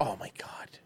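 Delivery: breathy whisper. Real, not acted. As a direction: whisper it in awe. Whispered reaction.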